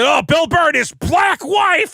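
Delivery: high, loud, slow mocking tone; mocking sneer. Imitating Cumia mocking Bill Burr, high loud slow sneer. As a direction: sneer it out like a bully. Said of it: "you said it like that".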